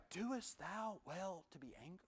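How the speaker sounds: soft, slow, gentle question; gracious God. Acted. God gently questioning Jonah, slow and tender. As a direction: ask it with patient grace.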